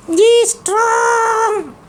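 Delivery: put-on high, slow child puppet voice; puppet boy voice. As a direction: play the cheeky little boy puppet.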